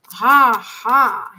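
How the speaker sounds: loud exhaled "ha"; real puffed-out "ha, ha"; loud breather. Real, not acted. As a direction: blow out a big breath, twice. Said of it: "Let me take a breather now".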